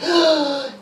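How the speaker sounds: sharp high gasp of delight; excited child gasp. Retold or imitated. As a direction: gasp like a thrilled little girl. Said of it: "She's, she's like".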